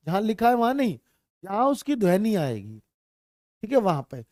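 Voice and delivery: ordinary delivery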